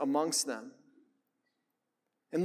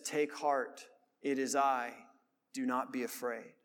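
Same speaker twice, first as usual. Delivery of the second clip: gentle reassurance; 6 semitones lower, 7 dB quieter than usual. Retold or imitated. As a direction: speak it softly like calming frightened men in a storm. Quoting Jesus' calming words.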